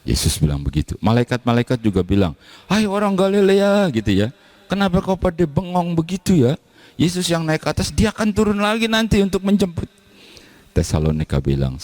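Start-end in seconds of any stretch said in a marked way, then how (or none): 2.7-3.9 much higher, proclaiming call to the Galileans; angel calling. Acted. 4.7-9.9 much higher, gently scolding heavenly messenger; angel speaking. Acted. Angels address the Galileans.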